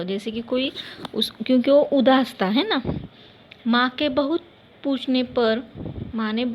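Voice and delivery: ordinary talk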